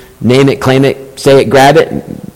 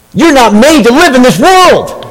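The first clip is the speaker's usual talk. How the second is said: higher, tender imitation of his wife; loving wife voice; 10 semitones higher than usual. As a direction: quote her lovingly, a touch put-on. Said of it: "in a very loving voice".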